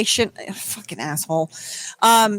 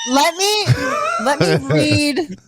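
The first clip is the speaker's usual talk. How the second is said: higher, mock-pleading let me read; mock eager wife; 5 semitones higher than usual. Mocking the bride begging to read the prenup, higher. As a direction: beg to read it, hammed-up.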